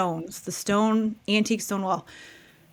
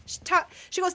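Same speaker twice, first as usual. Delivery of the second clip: ordinary delivery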